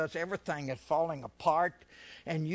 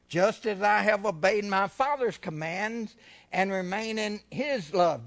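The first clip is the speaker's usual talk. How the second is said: forceful preaching; 4 semitones higher, 6 dB louder than usual. Acted. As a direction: proclaim each word from the pulpit. Preacher reads scripture forcefully.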